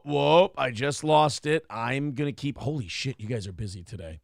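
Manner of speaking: ordinary delivery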